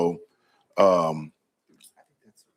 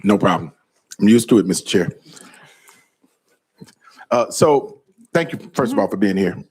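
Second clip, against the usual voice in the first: louder, projecting to the room; teacher voice; 2 semitones higher, 5 dB louder than usual. Real, not acted. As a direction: project like a teacher without a mic. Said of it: "teacher voice because my mic is not on".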